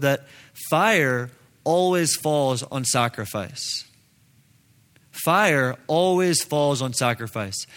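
usual speaking voice

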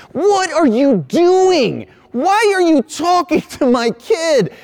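hammed-up high-pitched angry mom; outraged mom. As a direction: play the offended mom, drawn out high. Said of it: "the mom looks at me and she goes".

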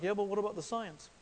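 quick, lightly mocking objector's voice. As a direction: lightly mimic a skeptical listener, quickly. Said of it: "I was just mocking you with that voice".

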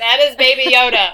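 loud, emphatic announcement; matter-of-fact declaration. Retold or imitated. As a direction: proclaim it loudly. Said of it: "I was like".

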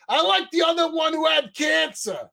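high, mock New York cabbie; cab driver jab. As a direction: lean out and fire the insult. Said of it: "he goes".

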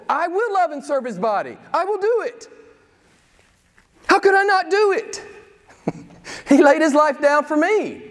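high-pitched, impassioned vow; devoted believer. Acted. Voicing the wholly committed believer's response.

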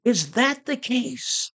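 high, slow mock surprise; calling back. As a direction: feign surprise, drawn out and high. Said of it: "Whitefield calls back".

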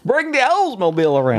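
high-pitched mock-Georgian impression; exaggerated Georgian put-on voice; Christopher Walken as Georgian. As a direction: call it out as a hammed-up Southern celebrity. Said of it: "Christopher Walken was Georgian".

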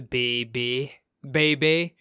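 put-on "baby" said as "beh-bay"; imitated pronunciation. Trying out a TV character's catchword, imitated pronunciation. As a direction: mimic her "baby", both syllables ending in "ay". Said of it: "how does she say it".

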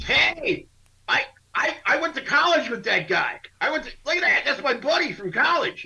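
excited coach. Retold or imitated. Coach on the sidelines spotting his college friend, high and eager. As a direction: play the thrilled old coach pointing out his buddy. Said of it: "He goes".

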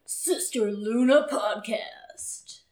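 exaggerated, drawn-out imitation of co-host; mock intense voice. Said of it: "and you're like".

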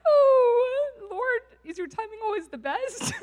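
high, loud laughing cry of mock despair; laughing plea to God. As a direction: laugh it out to the Lord, half crying. Mock exasperated prayer to God.

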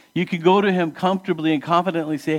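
ordinary delivery

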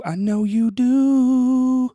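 very high, slow, drawn-out sing-song tease; teasing sing-song. Acted. Host teasing listeners who need tickets, high sing-song. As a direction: croon it back at them like a playful taunt.

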